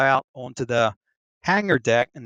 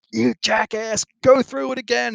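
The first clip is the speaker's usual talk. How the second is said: high, loud scolding shout; angry yell; 11 semitones higher, 5 dB louder than usual. Retold or imitated. Shellback yelling at the recruit who gave the wrong answer, angry shout. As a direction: bawl him out and send him back through. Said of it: "and he's like".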